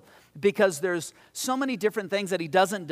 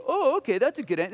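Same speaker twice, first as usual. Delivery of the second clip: high, sing-song fake acceptance; mock gullible; 9 semitones higher, 4 dB louder than usual. Preacher mocking how he'd be fobbed off, sing-song. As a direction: play the easily fooled listener, mocking. Mocks being fooled by answer.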